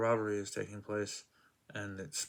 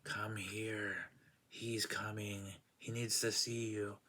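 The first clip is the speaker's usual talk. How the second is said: a lightly voiced, eerie beckoning, a touch softer; 4 dB quieter, slower than usual. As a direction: summon him like an unseen spirit, but only hinted. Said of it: "you hear a voice going".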